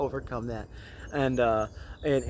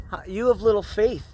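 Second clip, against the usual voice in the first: sharp, indignant retort, pitched higher; indignant rebuke; 6 semitones higher, 4 dB louder than usual. Retold or imitated. Jesus rebuking frightened disciples in the storm, indignant. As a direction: scold them for doubting. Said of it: "his response was one of indignation".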